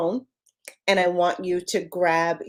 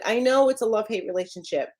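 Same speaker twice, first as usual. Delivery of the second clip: usual speaking voice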